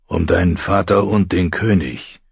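usual speaking voice

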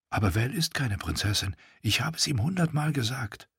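soft, brooding inner voice; John's soft inner excuse to himself. A narrator's reading.

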